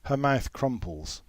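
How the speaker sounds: usual speaking voice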